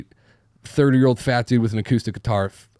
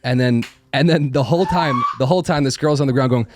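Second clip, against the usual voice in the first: ordinary talk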